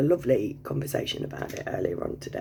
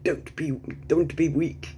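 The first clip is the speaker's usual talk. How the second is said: lightly voiced Libyan accent, firm; a hint of his Arabic accent, stern. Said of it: "I can't do his accent".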